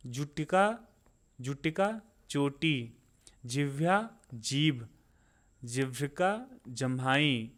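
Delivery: spaced word pairs, each word set apart; unhurried vocabulary drill; slow, deliberate recitation. Shown as a demonstration. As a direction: take your time so learners can say it along. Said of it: "मैं बहुत आराम आराम से बोल रहा हूँ".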